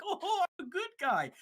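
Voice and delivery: high-pitched mock innocent villain; fake good guy. Villain tricking Terra into trusting him, high mock-innocent voice. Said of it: "trick Terra and be like".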